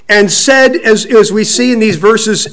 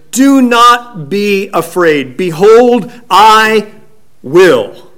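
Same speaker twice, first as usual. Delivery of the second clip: slow, ringing, raised declaration; proclamation; 3 semitones higher, 3 dB louder, much slower than usual. Retold or imitated. Preacher voicing God's answer to the fearful, slow and raised. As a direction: proclaim it word by word, dropping on the last.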